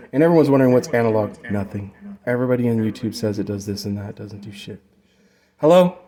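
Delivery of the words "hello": loud, high hello into the mic; mic test call. Shown as a demonstration. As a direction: project it to test the echo. Calling into mic to demo echo.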